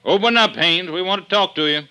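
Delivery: loud, high, forceful order through a door; shouting command. Acted. Detective yelling at the suspect behind the door. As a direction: bang out the demand to open up.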